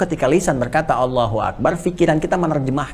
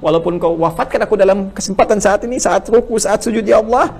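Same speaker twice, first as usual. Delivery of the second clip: higher, louder surrender to God; impassioned prayer; 6 semitones higher, 3 dB louder than usual. Acted. Preacher swelling into an impassioned plea of surrender. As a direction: let the plea swell with feeling.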